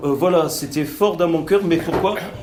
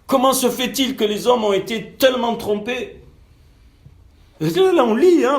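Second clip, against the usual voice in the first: indignation prêchée; 8 semitones higher, 4 dB louder than usual. Real, not acted. Prédicateur s'emporte contre les idoles.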